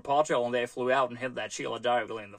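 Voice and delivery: put-on broad Australian accent, quick; strongest Australian accent. Shown as a demonstration. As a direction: have a go at a strong Aussie accent. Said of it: "Australian accent that I can do".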